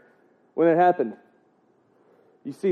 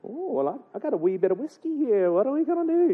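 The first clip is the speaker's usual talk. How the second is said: softer, swooping Irish lilt; mock Irish accent; 6 semitones higher, 5 dB quieter than usual. Irishman offers whiskey to his buddy, mock Irish accent. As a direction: offer a drink in put-on Irish. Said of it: "I'm gonna get that accent".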